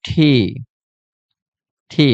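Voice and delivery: "ঠি" with breath released after the t; aspirated T. Shown as a demonstration. Teacher drilling the corrected T, "ঠি" aspirated each time. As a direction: puff the t of "ঠি". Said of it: "মানে ঠ".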